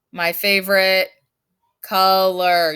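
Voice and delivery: teacher spelling out "My favorite color"; stress on favorite color. Shown as a demonstration. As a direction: lean on "favorite" and "color", slowly. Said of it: "You need to use favorite color".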